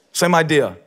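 ordinary delivery

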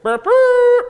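high, loud burping horn noise; a jokey toot of a trumpet; mock horn blast. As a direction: blurt it out like a silly little horn. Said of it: "what that horn's gonna sound like".

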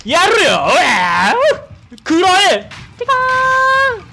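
high, hammed-up anime battle cry; screaming attack name. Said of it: "俺のライトニングフィンガーに".

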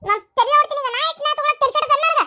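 put-on wife berating her drunk husband, shrill-pitched fury; angry rant. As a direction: tear into him for taking the money. Wife scolding husband over money.

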